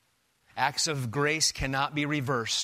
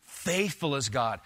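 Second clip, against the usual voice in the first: "Faithful" leaned on, read slowly; weight on "Faithful", then fall; emphasis on Faithful; 2 semitones lower than usual. Shown as a demonstration. Preacher reading the verse with emphasis on "Faithful".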